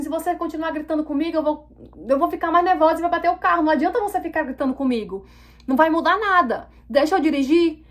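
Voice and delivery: heated, high-pitched scolding; indignant, insistent acted protest; retold angry retort. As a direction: re-enact standing up to someone who yells. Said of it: "eu falei desse jeito com ela".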